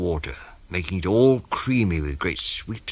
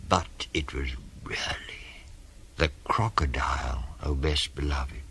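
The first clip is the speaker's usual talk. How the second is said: hushed reveal; 4 semitones lower, 6 dB quieter than usual. A narrator's reading. Narrator confides the secret to the beloved child, hushed. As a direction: drop down low and soft for the reveal.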